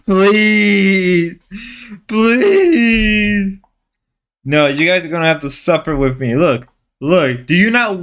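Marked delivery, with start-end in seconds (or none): 0.1-3.6 pleading. Pretend. Drawn-out begging after singing.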